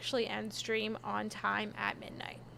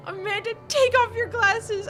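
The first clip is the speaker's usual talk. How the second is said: much louder and higher, calling across the seats; retold yell; 9 semitones higher, 10 dB louder than usual. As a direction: mimic a friend yelling a warning down the row.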